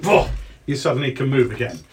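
ordinary delivery

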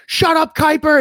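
high, loud mock shout; imitated yell. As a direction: yell it like a heated ringside manager. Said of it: "you can hear him yelling".

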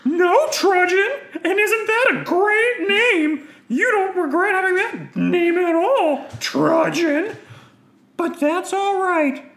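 very high, loud villain voice; mock in a slow, high theatrical voice; Knights of Ren leader. Leader lets Trudgeon down over joining, high loud villain voice.

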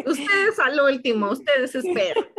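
laughing while playing the dismissive landlords; loud, amused mock command; laughing mock order. Guest mocks landlords telling them to wait, laughing.

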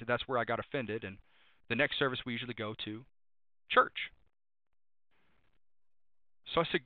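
ordinary talk, nothing marked

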